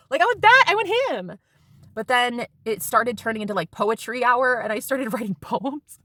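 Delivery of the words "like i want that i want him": high, emphatic wanting; high-pitched insistence; insistent. Retold or imitated. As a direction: plead like you mean it. Insisting she wants boyfriend.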